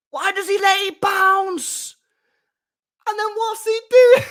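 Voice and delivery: high, loud incredulous yelling; exasperated shout. Real, not acted. Fan ranting at a defender letting the ball bounce, exasperated shout. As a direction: yell the question in disbelief.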